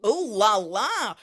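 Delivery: slow, loud, teasing; drawn out cheeky French exclaim; mock French ooh la la. Host teasing the French driver with a slow ooh la la.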